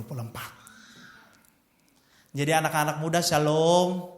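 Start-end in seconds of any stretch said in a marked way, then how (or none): none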